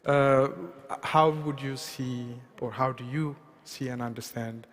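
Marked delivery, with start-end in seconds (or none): none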